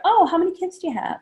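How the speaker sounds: high, quick friendly question; bubbly interest in their kids; eager follow-up. Shown as a demonstration. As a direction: hostess asking about the kids. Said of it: "Or if they say".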